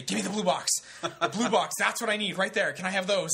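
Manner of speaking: high, loud, acted winded urgency; breathless. Buying condoms from the cashier after running, acted breathless urgency. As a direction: play it desperate and out of puff. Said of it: "I'm out of breath".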